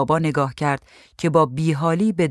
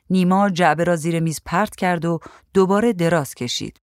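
ordinary delivery

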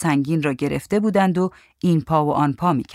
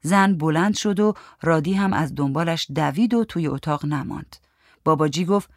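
ordinary delivery

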